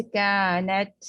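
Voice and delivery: کانت very slow with a strong puff of air on the t; over-aspirated ta, wrong. Shown as a demonstration. Teacher showing students the wrong breathy ta. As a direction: push too much breath on the t of کانت. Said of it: "نہ تو اس میں ہا کی آواز".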